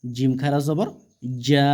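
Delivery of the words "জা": জা held long and steady; drawn-out vowel. Shown as a demonstration. Quran teacher showing one-alif length, জা drawn out. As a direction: stretch the vowel of জা. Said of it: "এগুলো টেনে পড়তে হবে একালিফ পরিমাণ".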